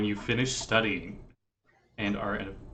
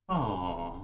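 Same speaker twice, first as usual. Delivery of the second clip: soft angelic choir aw; 6 semitones lower, 8 dB quieter than usual. Acted. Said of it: "angelic choir ... they'll go".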